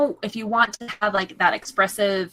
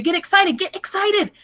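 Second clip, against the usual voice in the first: excited inflection; 11 semitones higher, 4 dB louder than usual. Shown as a demonstration. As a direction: pitch leaping way up. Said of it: "you need to have that inflection".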